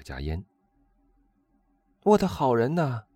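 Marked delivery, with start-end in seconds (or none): 2.0-3.0 high, loud cry of surprise; excited shout. A narrator's reading. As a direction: jump up and cry out to your friend. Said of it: "他跳起来喊道".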